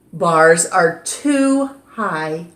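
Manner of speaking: usual speaking voice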